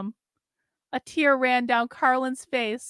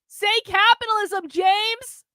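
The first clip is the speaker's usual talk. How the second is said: much higher urgent plea; pleading cry; 8 semitones higher than usual. Acted. Carlin cries out to James, high and urgent. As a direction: cry out the demand.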